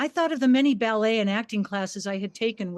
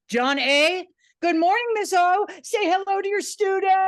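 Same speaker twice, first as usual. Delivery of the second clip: higher, cheery student greeting; student's roll-call reply; 8 semitones higher, 3 dB louder than usual. Retold or imitated. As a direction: answer roll with flair. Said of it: "you want an example".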